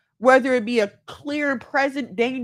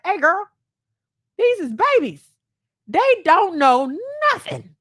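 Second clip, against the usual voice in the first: higher, louder imitation of her husband; husband's voice; 7 semitones higher, 5 dB louder than usual. Wife imitating her husband telling her the kids are babies. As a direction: playfully imitate the husband setting her straight. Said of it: "my husband had to sit me down and be like".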